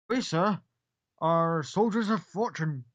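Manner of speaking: slow, loud, grand declaration; proud Gith soldier. Acted. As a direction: puff up with mercenary pride.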